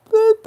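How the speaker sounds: high, loud "boop"; censor bleep. Acted. Hiding the group's name from listeners, high bleep noise. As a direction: cover the name with a piercing boop.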